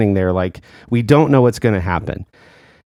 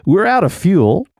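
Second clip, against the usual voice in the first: captain announcement; 8 semitones higher than usual. Retold or imitated. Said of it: "The captain comes on and he goes".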